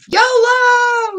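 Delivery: high, loud drawn-out shout; calling out. Real, not acted. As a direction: holler the name in greeting. Calls out a viewer's name.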